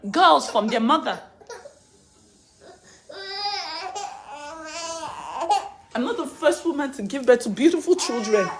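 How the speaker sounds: loud, high, desperate cry; anguished. Real, not acted. Mother crying out against losing her kids, loud and desperate. Mother pleading to keep children.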